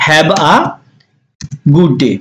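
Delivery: long pause before good day; word by word. Shown as a demonstration. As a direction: model it piece by piece. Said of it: "আমরা কিন্তু বলি এভাবে".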